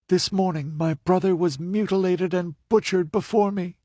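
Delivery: high, earnest telling of her story; a captive recounting her loss; grieving young woman. A narrator's reading. Livia's voice in narration.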